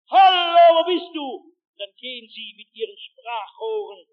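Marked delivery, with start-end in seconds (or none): 0.1-1.3 high hallo, loud then easing off; searchers bellowing. Retold or imitated. Rescuers searching the prairie for him, bellowing. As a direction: call out for someone lost. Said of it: "mit denen sie brüllten".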